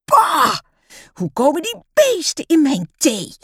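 high, loud outburst of disgust; revolted yell. A narrator's reading. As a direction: demand an explanation, yelling in revulsion. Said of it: "gilt ze".

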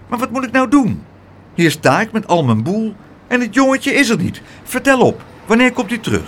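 put-on harried woman demanding the child; impatient aunt. Aunt complains to Pluk about her move, impatient and high.

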